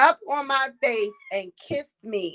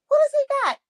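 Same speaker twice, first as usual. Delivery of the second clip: high surprised squeal; sudden high-pitched question; much faster than usual. Real, not acted.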